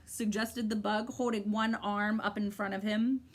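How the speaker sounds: ordinary delivery, nothing marked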